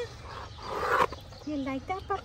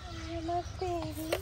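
sweet nothings; 2 semitones higher, 9 dB quieter than usual. Real, not acted. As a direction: gently coax a little one. Coaxing chimp to sit.

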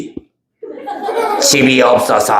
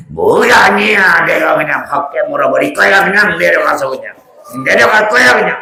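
menacing devil; 6 semitones higher than usual. Acted. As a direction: growl out the threat like a playful monster. Devil threatens to bite audience.

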